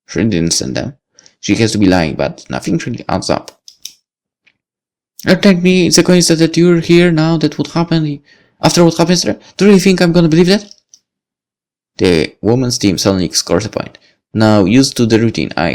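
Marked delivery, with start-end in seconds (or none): 5.2-10.6 put-on higher, suspicious young woman; accusing Lucy. Lucy confronting the boy from her apartment, suspicious. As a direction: accuse him of stalking.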